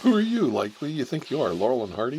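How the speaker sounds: sarcastic challenge; incredulous. Retold or imitated. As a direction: scoff at him. Mocking the classmate's pomposity.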